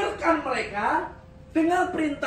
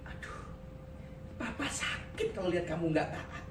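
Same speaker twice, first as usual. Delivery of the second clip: much softer, mildly hurt gentle dad voice; gentle father; 6 semitones lower, 11 dB quieter than usual. Retold or imitated. Gentle father softly chiding a disobedient child, much softer. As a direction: sigh it out like a soft-hearted father. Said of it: "papa kita orang yang lemah lembut".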